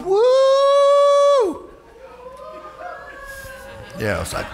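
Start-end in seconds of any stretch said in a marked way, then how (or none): 0.0-1.7 high, loud worship whoop; joyful whoop. Retold or imitated. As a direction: let out a full-throated shout of joy. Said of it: "seeing yourself going".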